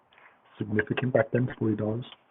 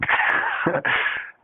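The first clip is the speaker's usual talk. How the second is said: breathy laugh; 5 dB louder than usual. Real, not acted. Laughing at wanting knife back.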